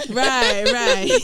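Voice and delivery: high-pitched, laughing through the words; laughing agreement. Real, not acted. Friend teased about smiling, laughing agreement. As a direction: agree while giggling. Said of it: "I love how she's smiling".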